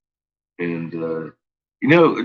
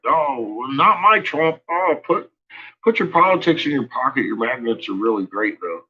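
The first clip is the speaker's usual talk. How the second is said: mocking people's reply; 7 semitones higher than usual. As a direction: needle them in a higher, sneering voice. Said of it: "they're all like".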